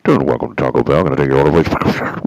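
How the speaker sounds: loud, fast drive-thru speaker voice; like a crackly drive-thru intercom. Retold or imitated. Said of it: "Let me see if I can do this".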